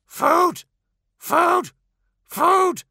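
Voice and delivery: high loud squawking crow voice; crow calls. Acted. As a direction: caw the word like a hungry crow. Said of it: "they called at him".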